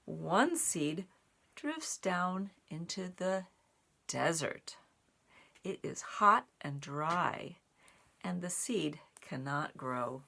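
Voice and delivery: steady read-aloud; reading to children. A narrator's reading. Reading the desert page to children, calm storytime voice. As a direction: tell of the dry desert. Reads picture book aloud.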